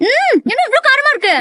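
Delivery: high-pitched, wailing complaint; shocked at the heat; startled by spice. Acted. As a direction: yelp as if the mouth is burning. Reacts to very spicy snack.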